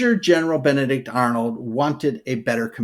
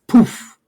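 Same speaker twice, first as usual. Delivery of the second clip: high, loud playful poof; mock flare poof; 9 semitones higher, 6 dB louder than usual. Describing the signal flare, playful poof sound. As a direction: pop it out like a firework.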